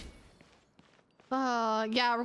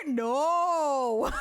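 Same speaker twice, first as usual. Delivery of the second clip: loud no into high laughter; shouted no; 6 semitones higher, 4 dB louder than usual. Real, not acted. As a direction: bark a refusal then laugh. Refusing to hand in letter.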